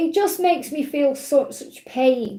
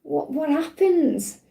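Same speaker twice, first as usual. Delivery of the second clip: slow, lightly voiced small child asking; a hint of a wondering child, slowed down; little child; 2 semitones higher, slower than usual. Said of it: "that little child coming up to Charlie Morley and saying".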